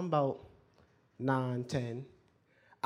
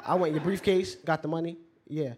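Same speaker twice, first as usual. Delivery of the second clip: ordinary talk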